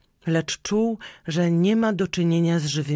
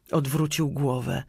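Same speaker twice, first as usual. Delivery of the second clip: ordinary delivery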